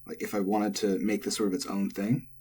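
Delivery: usual speaking voice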